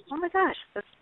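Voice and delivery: opgetogen uitroep, veel hoger; excited mom. Retold or imitated. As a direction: enthousiaste moeder. Mom praising child's good deed.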